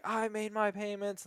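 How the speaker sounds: high, drawn-out indignant protest; stretched, high-pitched angry insistence; mock pissed-off debtor. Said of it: "they start off super pissed".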